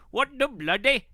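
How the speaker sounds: put-on high, lilting Indian accent. Streamer reading a chat message, mock Indian accent. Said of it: "reading that in an Indian accent".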